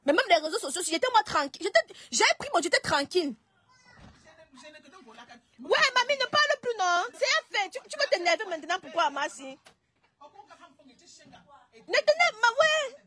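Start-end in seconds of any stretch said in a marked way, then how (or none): 5.6-13.0 high, begging tone; pleading. Real, not acted. Granddaughter begs her grandmother to stop talking on live, high and pleading.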